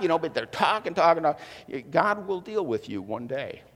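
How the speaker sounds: ordinary talk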